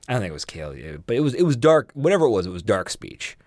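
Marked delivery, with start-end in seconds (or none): none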